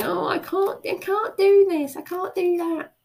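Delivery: high, whining "I can't" voice; mock a sorry-for-yourself moaner; whiny self-pity. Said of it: "Not necessarily in that voice".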